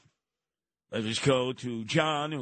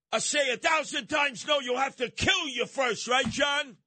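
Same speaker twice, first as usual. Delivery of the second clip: defiant shout; 10 semitones higher than usual. Acted. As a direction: shout it like a protest. Defiant rant about AM radio.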